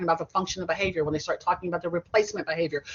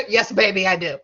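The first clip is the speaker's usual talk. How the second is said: louder, amused reply through a laugh; 8 dB louder than usual. Real, not acted. Teacher repeating her answer to kids, laughing. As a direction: laugh as you reassure.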